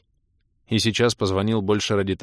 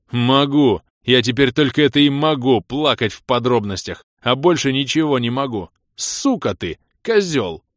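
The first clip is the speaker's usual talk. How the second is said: loud, raised angry complaint; aggrieved outburst; 4 semitones higher, 6 dB louder than usual. Acted. Влас snaps back at friend's request, loudly aggrieved. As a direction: rant and end on swearing. Injured caller rants, swears.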